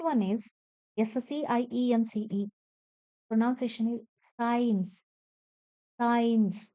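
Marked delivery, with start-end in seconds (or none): none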